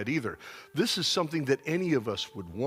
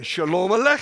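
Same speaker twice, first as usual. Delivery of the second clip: loud, raised teaching call; call-and-response model; 4 semitones higher, 7 dB louder than usual. Shown as a demonstration. Preacher drilling congregation again, loud projected call. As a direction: belt out the greeting for a crowd to echo.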